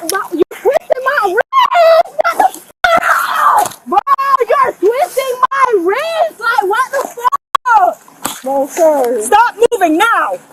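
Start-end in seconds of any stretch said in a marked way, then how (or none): 0.1-8.4 high, slow pained shout; crying out in pain. Real, not acted. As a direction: cry out as the wrist twists. Wrist twisted during arrest.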